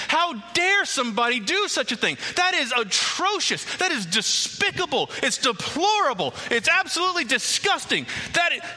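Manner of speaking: David furiously ordering Nathan to find the man; indignant fury. Acted. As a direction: rage at the unknown culprit, fired up. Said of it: "He is so indignant".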